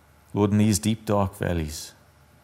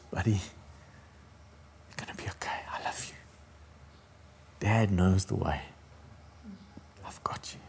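whisper; 8 dB quieter than usual. Retold or imitated. Father reassuring his boy on the way home, whispering. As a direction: hush it like soothing a child. Father's comfort quoted again.

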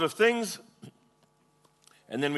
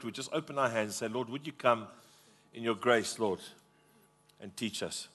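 low, soft, halting prayer; hushed pleading to the Lord; 3 semitones lower, 5 dB quieter than usual. Real, not acted. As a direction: pray quietly and low, pausing between pleas. Leads the congregation in prayer.

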